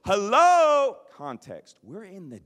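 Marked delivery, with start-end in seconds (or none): 0.1-1.0 高声挑衅地喊你好; sudden high, loud confrontational call; loud challenge. Retold or imitated. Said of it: "He goes".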